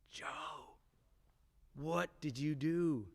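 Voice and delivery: hushed, slow whisper of disbelief; whisper to self. Retold or imitated. Boy whispering to his reflection in the school mirror. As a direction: whisper at your own reflection, stunned.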